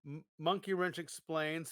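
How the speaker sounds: normal talking voice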